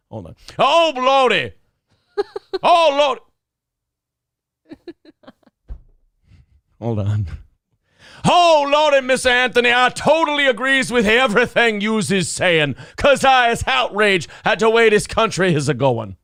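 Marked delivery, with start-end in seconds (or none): 0.6-4.8 high, loud, exaggerated mock-servant voice, pitched well up; Perry's caricature voice. Reenacting the Perry bit for the stream, high loud exaggerated caricature voice. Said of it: "I will play audio of Perry". 8.3-16.2 high, loud, exaggerated mock-servant voice, pitched well up; Perry's caricature voice. Reenacting the Perry bit for the stream, high loud exaggerated caricature voice. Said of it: "I will play audio of Perry".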